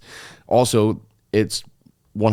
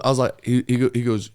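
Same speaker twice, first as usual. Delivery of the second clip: normal talking voice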